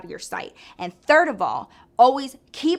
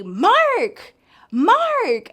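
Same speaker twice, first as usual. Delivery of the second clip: high, loud call across a noisy bar; yelling a name; 10 semitones higher, 7 dB louder than usual. Retold or imitated. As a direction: holler it out, drawn out and high. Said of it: "they all be yelling like that".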